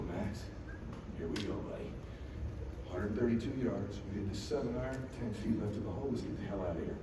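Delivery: low, soft game plan; hushed coaching. Retold or imitated. Dad caddying for son under pressure, hushed coaching. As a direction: quietly lay out the shot. Calm instructions to his son.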